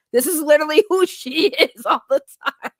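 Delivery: breaking into laughter mid-sentence; laughing. Real, not acted. Streamer quoting viewers who know her volume, laughing. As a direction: let laughter take over the end.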